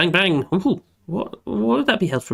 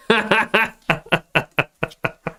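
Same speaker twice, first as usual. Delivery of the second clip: hearty laugh; 5 semitones higher than usual. Real, not acted. Laughing at chat.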